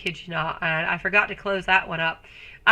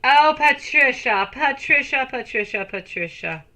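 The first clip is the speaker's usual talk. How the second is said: sing-song name call; 4 semitones higher, 3 dB louder than usual. Acted. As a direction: sing her name teasingly, letting each repeat fall away. Greeting a viewer by name.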